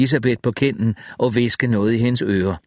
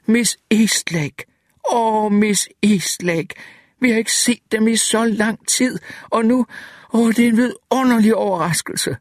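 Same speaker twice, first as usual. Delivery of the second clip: put-on overjoyed old bookkeeper's voice; excited exclamation; 12 semitones higher than usual. A narrator's reading. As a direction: gush with joy at seeing her again. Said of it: "udbrød".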